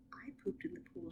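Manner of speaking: whispered, quick and high; whispered confession. Acted. Worker's confession.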